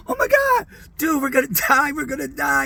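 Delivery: high, panicked cry of terror; panicked screaming. Retold or imitated. Passenger in heavy turbulence screaming to the band, high panic. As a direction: shriek like the plane is going down. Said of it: "I'm, like, screaming".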